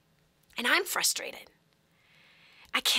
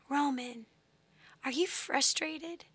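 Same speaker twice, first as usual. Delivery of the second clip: soft, slow and gentle; tender question; 2 semitones higher, 7 dB quieter, slower than usual. Retold or imitated. Mother asking her screaming son, soft tender voice. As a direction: ask softly, as to an upset child.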